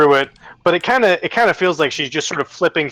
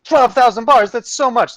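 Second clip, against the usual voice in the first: high, loud incredulous echo; over-the-top astonished TV reaction; mock amazement; 10 semitones higher, 4 dB louder than usual. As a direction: gasp at the sum in mock awe. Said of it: "they have the same impact".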